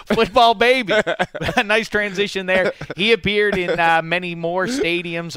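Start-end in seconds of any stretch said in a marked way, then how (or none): 0.1-0.9 excited. Acted. Show segment catchphrase.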